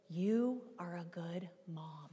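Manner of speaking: slow, soft whispered line; a toddler whispering comfort to his mom. Retold or imitated. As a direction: breathe it gently like a small child.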